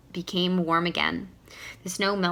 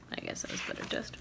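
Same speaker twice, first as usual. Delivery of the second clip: muttered aside; 12 dB quieter, faster than usual. Real, not acted.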